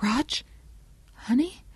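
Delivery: hushed, high rising appeal; whispered. A narrator's reading. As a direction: softly try to reach your husband. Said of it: "Shelley whispered".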